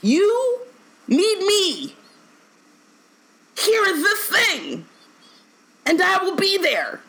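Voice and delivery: very high, slow pleading voice; high mock Sirius. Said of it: "Sirius said explicitly".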